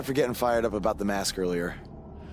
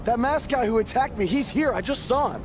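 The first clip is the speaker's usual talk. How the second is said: high urgent alarm; 9 semitones higher than usual. Real, not acted. Buzz insists to his promoter he saw the attacker. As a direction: blurt it out, rattled and insistent.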